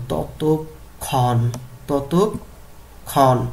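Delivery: ততক্ষণ drawn out as to-tuk, pause, khon; slow pronunciation drill. Shown as a demonstration. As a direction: stretch ততক্ষণ very slowly, breaking before khon. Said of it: "উচ্চারণ করতে হবে এইভাবে".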